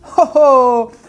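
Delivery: big high laugh; loud laugh. Real, not acted. Laughs again.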